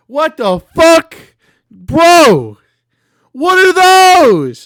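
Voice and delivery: loud, high, slow disbelief; shocked shout. Real, not acted. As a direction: yell in shock. Shocked by character images.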